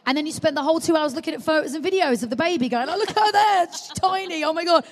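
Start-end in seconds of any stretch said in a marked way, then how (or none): 2.9-4.8 mock cooing. Cooing over baby photos.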